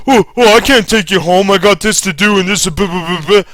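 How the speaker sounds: high, loud, dismissive brush-off; mock whiny boss. Boss refusing to drive the injured worker home, mock whiny. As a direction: brush him off like a lazy boss.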